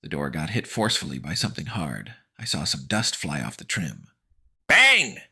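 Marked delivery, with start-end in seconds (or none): 4.7-5.2 explosive high shout; loud, startling sound-effect word; sudden bang. A narrator's reading. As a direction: shout it like a blow on the door. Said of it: "This one was even louder".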